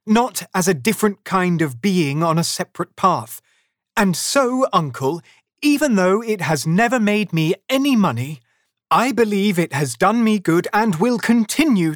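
high, animated, heartfelt speech; earnest nephew. A narrator's reading. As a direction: champion Christmas with conviction. Voices nephew's speech in praise.

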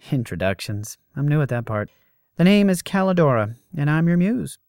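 high, loud, breezy woman; brash muse voice. Acted. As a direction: play a cocky young goddess. Voices Calidora in the story.

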